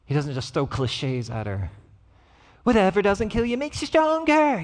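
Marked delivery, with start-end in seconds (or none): 2.6-4.6 high, mock-singsong platitude; mocking cliché. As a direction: toss off a tired cliché with scorn.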